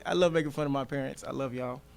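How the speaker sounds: ordinary delivery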